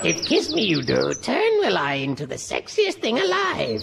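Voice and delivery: low, croaky Jedi master; Yoda voice. Acted. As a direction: backwards talking little sage.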